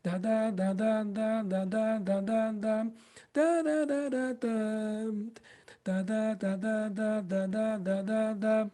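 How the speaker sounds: soft "dá" articulation, gentle onset; soft dá syllables. Shown as a demonstration. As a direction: glide "dá" lightly, barely tongued. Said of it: "E o dá é menos intenso ainda".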